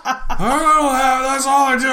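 thief denying. Retold or imitated. Shoplifter denying to the clerk, high laughing mock voice. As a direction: plead in a caricature whine.

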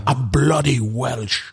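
slow, drawn-out Welsh movie impression; attempted Welsh accent. Quoting The Replacements to friends, slow mock Welsh accent. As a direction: stretch it out in a rough Welsh accent. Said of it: "Forgive me if that accent was off".

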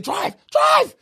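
high-pitched yelled order to drive; urgent shout. Retold or imitated. Acting out the panicked taxi scene.

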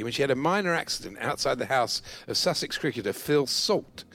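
usual speaking voice

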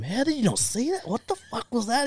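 high, disbelieving foreman; incredulous foreman. Retold or imitated. Foreman disbelieving as Dan walks into someone, high and incredulous. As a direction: voice the stunned foreman, pitched well up. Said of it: "foreman reacting".

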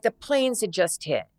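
normal talking voice

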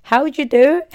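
loud, high, hammy Australian accent; attempted Australian accent. Shown as a demonstration. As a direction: greet loudly in a bad Australian accent. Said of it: "What's an Australian accent?".

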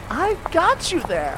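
put-on a young girl turning down a stranger, prim and high; wary girl. Girl refusing the stranger's ride, high and prim. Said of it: "so here are the lyrics".

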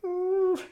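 high wordless "ooh"; high yelp. Retold or imitated. Storyteller as a hornet climbs into his shorts, alarmed squeal. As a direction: let out a thin, high rising whimper.

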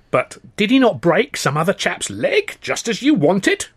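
high, slow crone voice, pointed. A narrator's reading. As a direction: needle him slowly like a knowing old crone.

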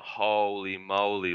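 slow, drawn-out mock amazement; mildly hammed-up awe; imitating amazed onlookers. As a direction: stretch it out like an awed crowd. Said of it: "everyone going".

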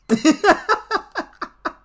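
very high burst of laughter; squealing laugh; real laughter. Real, not acted. Laughs at the first-date idea.

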